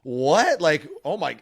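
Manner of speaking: a startled "What?"; surprised. Retold or imitated. Seeing wife in tears.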